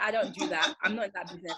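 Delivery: usual speaking voice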